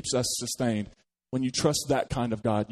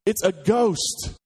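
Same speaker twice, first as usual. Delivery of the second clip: high, slow frightened exclamation; startled disciples crying out in fear; scared cry; 7 semitones higher, 4 dB louder, much slower than usual. Acted. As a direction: gasp it out like you've seen a spook. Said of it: "his disciples go".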